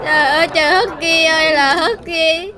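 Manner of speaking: tearful, hammed-up farewell; acted crying. Owner saying goodbye to pet Husky, acted crying. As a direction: wail a goodbye through pretend sobs. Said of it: "có gì đâu mà khóc thấy ghê vậy".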